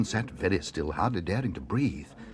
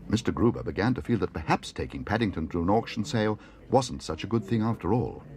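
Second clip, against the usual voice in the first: plain reading voice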